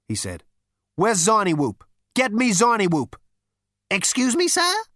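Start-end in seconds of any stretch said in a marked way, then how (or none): 0.9-3.1 loud, cocky and impatient; brash Zaphod. Acted. Zaphod demands Zarniwoop. 3.9-4.8 high, frosty prissy bug voice; icy insect. Acted. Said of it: "said the insect icily".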